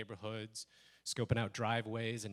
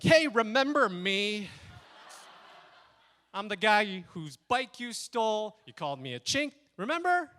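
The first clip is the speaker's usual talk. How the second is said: letting loose; 7 semitones higher, 9 dB louder than usual. Retold or imitated. Calling the bike thief back, angry shouting. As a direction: blast him, leaping up on the last word. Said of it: "I just let loose again".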